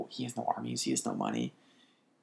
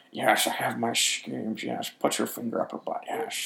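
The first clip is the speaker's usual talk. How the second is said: mock character voice with slushy sh-sounds; slow, slurred s turning into sh; hammed-up impression, every s smeared into sh; Littlefinger. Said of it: "talking about".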